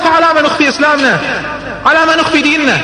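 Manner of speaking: zealous challenge; passionate protest. Retold or imitated. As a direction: press the case with fervor. Umar urges going public.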